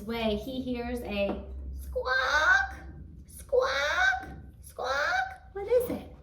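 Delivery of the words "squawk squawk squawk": high squawk, much higher; hen squawk. Acted.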